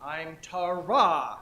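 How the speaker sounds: slow "Tara", leaning on the final "ra"; stress on last syllable. Shown as a demonstration. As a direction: stretch and stress the end of Tara. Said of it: "You put the emphasis on the back".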